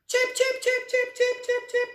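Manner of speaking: very high, loud, rapid chirps; chirping robins. Acted. Storyteller voicing two worried robins, high loud chirping. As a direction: peep like agitated little birds.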